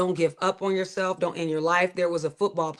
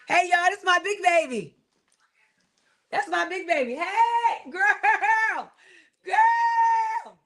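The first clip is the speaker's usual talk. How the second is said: excited greeting; 11 semitones higher, slower than usual. Real, not acted. Greeting her child arriving.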